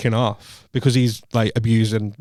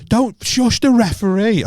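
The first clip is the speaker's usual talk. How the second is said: mock shout, pitched up and drawn out; posh heckler's shout; 9 semitones higher, slower than usual. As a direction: imitate an affronted posh onlooker, high and slow. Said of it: "that's the poshest shout I've ever heard".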